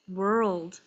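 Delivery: normal talking voice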